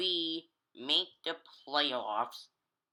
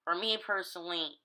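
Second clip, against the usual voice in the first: ordinary delivery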